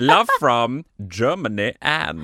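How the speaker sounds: slow sign-off in a put-on German accent. Signing off a fan's postcard, slow German accent. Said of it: "I shall go for this German accent".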